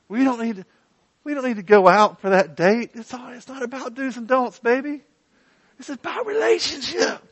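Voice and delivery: high, mocking wheedle to the wife; mock sweet-talking. Preacher mocking a husband talking his wife out of a date, high wheedling voice. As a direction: ham up a weaselly husband dodging date night. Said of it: "the idea that I would say to my wife".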